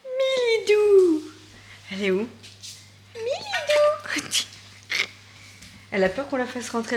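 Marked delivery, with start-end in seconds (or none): 0.0-1.2 calling out high and drawn out; appel au chat. Real, not acted. Calling the cat outside. 3.1-4.3 very high, stretched call; appel au chat. Real, not acted. Calling the cat again.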